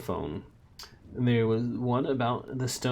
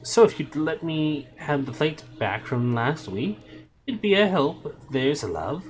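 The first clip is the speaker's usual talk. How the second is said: higher, chirpy landlady voice; Madame Tracy voice; 6 semitones higher, 5 dB louder than usual. A narrator's reading. Landlady chatting to Shadwell about Sunday, chirpy higher voice. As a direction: play the cheerful landlady. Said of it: "said Madame Tracy".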